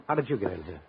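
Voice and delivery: normal talking voice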